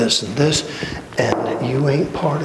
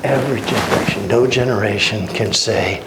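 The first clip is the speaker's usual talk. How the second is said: usual speaking voice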